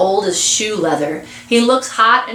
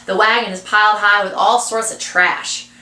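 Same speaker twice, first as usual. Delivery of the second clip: plain reading voice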